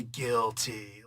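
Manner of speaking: low pronounced verdict; movie-line impression. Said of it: "like that because that was freaking".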